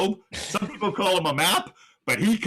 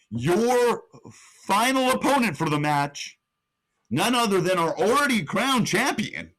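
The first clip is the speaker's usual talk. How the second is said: big, high-pitched reveal and match call; announcer play-by-play; 4 semitones higher, 3 dB louder than usual. Acted. Revealing D'Lo Brown as final opponent, excited announcer call. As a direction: announce the surprise entrant, then call the finish excitedly.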